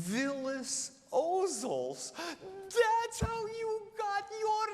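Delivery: slow, then a high loud cry; rising to a cry. Retold or imitated. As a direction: build to a proud exclamation. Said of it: "And she said".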